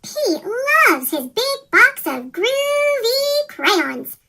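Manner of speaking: ordinary delivery, nothing marked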